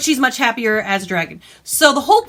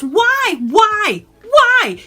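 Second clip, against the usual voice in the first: rising, ever more exasperated why; each why higher and louder; 8 semitones higher, 4 dB louder, much slower than usual. Real, not acted. Vlogger pleading with the author, growing exasperation.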